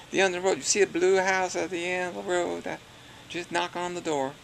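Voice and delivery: West Virginia twang imitation. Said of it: "What does it sound like?".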